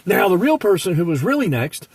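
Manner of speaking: normal talking voice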